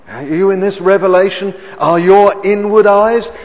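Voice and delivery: loud, high, fast preaching; full-force preaching. Acted. Preacher urging the congregation to see, loud and fast. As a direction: drive it home to the congregation.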